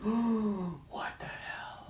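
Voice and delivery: very slow, voiceless disbelief; stunned whisper. Retold or imitated. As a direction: whisper it drawn out, as if aghast. Retold stunned reaction, hiding indoors.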